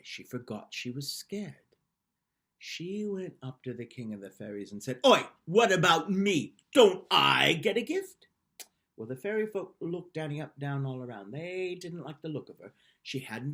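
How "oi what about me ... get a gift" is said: loud, pushy and high; indignant demand. Acted. Bossy girl confronting the fairy king, loud and indignant. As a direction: demand your share, slowly and boldly.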